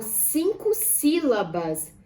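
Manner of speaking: ordinary delivery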